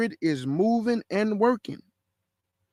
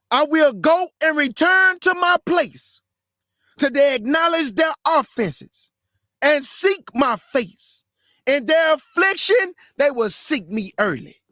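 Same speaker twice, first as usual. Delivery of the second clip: fiery preaching; 5 semitones higher than usual. Acted. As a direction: proclaim it from the pulpit. Preacher reads verse at full force.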